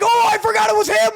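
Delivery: squeaky high-pitched anime voice. Pretend. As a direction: pitch way up like a cartoon girl. Acted anime character line.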